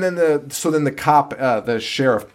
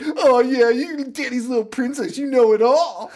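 high mock Lori voice; hammed-up ranting Lori, pitched high; toned-down screaming Lori; 10 semitones higher than usual. Lori sneers at her friend, high mock voice. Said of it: "I'm doing it at, like, a toned down version".

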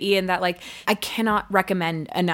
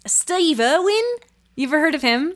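much higher, rising name-drop; high-pitched, leading question; gushing fan question; 9 semitones higher than usual. Acted. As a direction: say the idol's name with gleeful emphasis. Fan asks bartender about idol.

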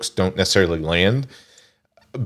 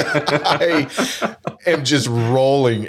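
laughing; 6 semitones higher, much slower than usual. Real, not acted. Laughing while recounting funny scene.

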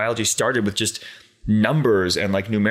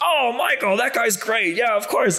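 imitating the boss; slower than usual. Boss voiced in story.

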